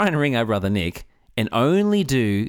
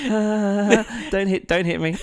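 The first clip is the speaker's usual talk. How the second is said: high, playfully panicked pleading; mock fright; 11 semitones higher, slower than usual. Listener pleading with an over-heated storyteller, mock frightened. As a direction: cower from an imagined blow, half laughing. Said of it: "it's frightening".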